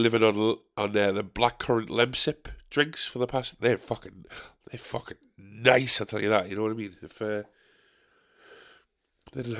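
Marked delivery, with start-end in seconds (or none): none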